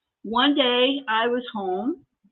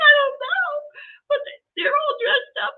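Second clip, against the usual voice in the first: retold panicked crying. Wife telling husband about men in the driveway, hammed-up crying. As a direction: squeal it out as a hammed-up tearful wail. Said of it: "And now I'm crying".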